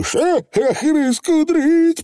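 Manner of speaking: high, slow drawn-out Feegle oath; high stretched pixie curse. Acted. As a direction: swear under pressure. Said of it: "пробормотал Явор".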